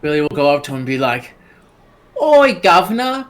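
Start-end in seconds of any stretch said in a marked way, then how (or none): 2.2-3.3 put-on high, loud cheeky British accent; hammed-up British street greeting; mock British accent. Billy accosts a British Santa, mock British accent. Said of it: "Does he talk British?".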